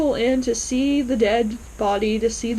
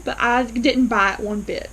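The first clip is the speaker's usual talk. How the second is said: normal talking voice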